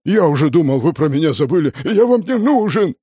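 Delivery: loud, high whiny complaint; whining. Acted. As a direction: whimper like a hurt pet. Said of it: "захныкал он низким басом".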